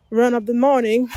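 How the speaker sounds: ordinary talk, nothing marked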